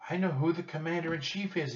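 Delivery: high-pitched burst of realization; excited exclamation. A narrator's reading. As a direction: exclaim it high, amazed at what you know. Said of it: "he exclaimed".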